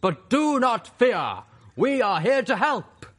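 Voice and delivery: booming, high, drawn-out proclamation; royal Canterlot voice. A narrator's reading. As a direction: thunder out the reassurance like a royal herald. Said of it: "always speak in the royal Canterlot voice".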